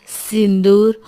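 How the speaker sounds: ordinary delivery, nothing marked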